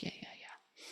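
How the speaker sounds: breathy dismissive whisper imitating him. Ex-boyfriend brushing off her warning, imitated in a whisper. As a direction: mutter it under your breath like he did.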